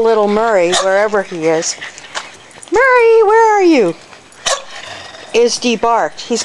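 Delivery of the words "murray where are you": high, louder call for the dog; high loud call searching for a dog. Real, not acted. Calling for missing dog.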